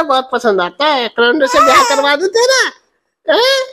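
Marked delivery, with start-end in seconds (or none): 1.1-3.6 playful teasing. Real, not acted. Father teasing baby about loving her calendar, playful and quick. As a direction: tease her playfully, a bit fast.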